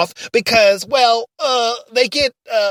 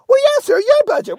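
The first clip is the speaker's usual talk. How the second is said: squeaky sidekick. Acted. Ray's high timid reply.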